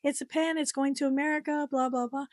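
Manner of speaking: mocking the clerk. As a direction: parody her phone call dismissively. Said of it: "She was on the phone just like".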